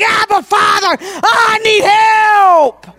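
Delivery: preacher's pleading wail; crying out to God. Acted. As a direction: beg God for help at full force.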